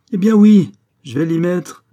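loud, high exasperated outburst; enraged surrender. A narrator's reading. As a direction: give in at the top of your patience. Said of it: "C'était à devenir enragé".

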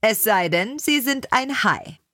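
lightly voiced Julia Child, rising high on the punchline; a playful TV-chef wink at the end; Julia Child joking. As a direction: TV chef's joking aside to her audience. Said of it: "sagte sie".

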